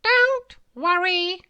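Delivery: high, loud, slow kindly bird; comforting bird voice. A narrator's reading. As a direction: reassure the worm as a chirpy bird. Kind bird comforts worm.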